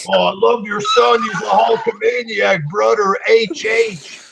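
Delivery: high, hammed-up wrestler reply; mock Hulk voice. Hulk answering the fan's tweet, hammed-up mock reply. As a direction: toss off a phony gushing reply, brother.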